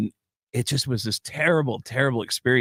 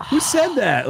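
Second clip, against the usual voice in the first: high, louder mock outrage; indignant; 13 semitones higher, 5 dB louder than usual. Groom's friend interrupted mid-toast, indignant. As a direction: demand to know the heckler. Acts out his reaction to heckler.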